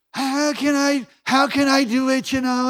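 imitating a self-pitying whiner; whining. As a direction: whine it like a reluctant complainer. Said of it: "just whining".

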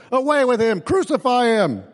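high mock yell of the crowd; a hammed-up crowd cry, pitched high. A narrator's reading. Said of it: "they yelled".

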